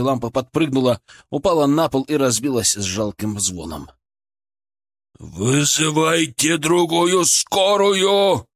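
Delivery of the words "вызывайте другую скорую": shouting. A narrator's reading. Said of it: "орал он в трубку".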